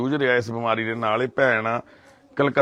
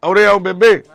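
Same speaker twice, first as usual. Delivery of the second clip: calling out; 7 semitones higher, 7 dB louder than usual. Real, not acted. Calling upset mother over to him.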